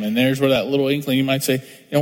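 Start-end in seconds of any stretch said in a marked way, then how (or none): none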